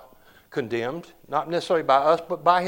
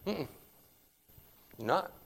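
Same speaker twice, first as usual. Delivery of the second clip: normal talking voice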